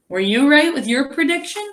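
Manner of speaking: ordinary talk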